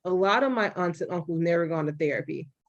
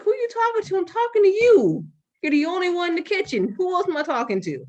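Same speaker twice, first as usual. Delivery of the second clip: much higher mock yell, loudest on the middle line; mom yelling back; 9 semitones higher than usual. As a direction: play the indignant mom snapping back. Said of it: "She's like".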